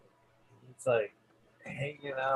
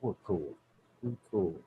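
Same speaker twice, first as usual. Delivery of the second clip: inner thought; 5 semitones lower, 9 dB quieter than usual. Retold or imitated. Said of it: "think to myself".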